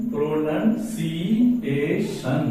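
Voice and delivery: slow "प्रोनंसिएशन", broken into its syllables; teacher slowly sounding out "प्रोनंसिएशन" in parts. Shown as a demonstration. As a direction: draw out each syllable of "प्रोनंसिएशन". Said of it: "syllable के आधार पर".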